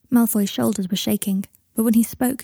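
plain reading voice

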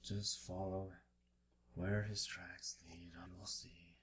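soft voice fading into whisper. Acted. Kneeling man sends travellers after the tracks, whispering. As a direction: breathe the guidance out softly, with pauses. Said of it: "you can hear him whispering".

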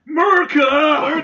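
hammed-up patriotic yell; shout. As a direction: bellow it like a rally cheer. Patriotic mock cheer.

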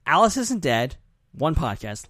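normal talking voice

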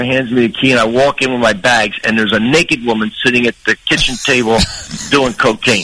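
normal talking voice

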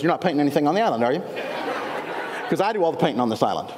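low, rapid mimicry of a possessive local; guard your trade, hurried and mock-suspicious; territorial, standoffish. Island painter to possible rival, mock territorial. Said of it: "they were very territorial and standoffish".

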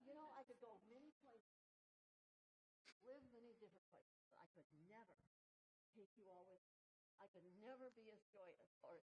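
hushed sincere gratitude to the church; quiet moved thank-you to the congregation; soft heartfelt thanks. Real, not acted.

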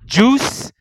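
loud, high announcer call; hype announcement. Real, not acted. Announcing the matchup.

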